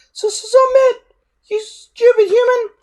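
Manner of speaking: very high, loud stammering wail; a scared dragon who can't watch; moaning little dragon. A narrator's reading. Toothless shrieks at Hiccup.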